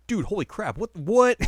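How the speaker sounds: high, loud disbelief; amazed mock outburst. Podcaster acting friends reacting to the new title, astonished.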